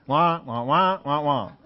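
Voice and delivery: muffled trombone-like wah wah; Peanuts adult voice. Retold or imitated. Preacher imitating the Peanuts adults, wah wah with a laugh. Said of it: "like watching the Peanuts".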